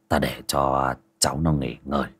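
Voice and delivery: put-on low, soft old master's voice; low calm master. A narrator's reading. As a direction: speak quietly like a grave old sage. Ông thầy nói với bà Báu.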